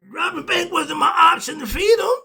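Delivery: loud, high, near tears; shouting. Real, not acted. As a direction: shout it out, voice cracking. Heated outburst about robbing banks.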